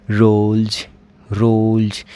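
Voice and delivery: रोल्स said as "rolj"; voiced j ending. Shown as a demonstration. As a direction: end रोल्स on a buzzed j. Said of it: "वो क्या होगा?".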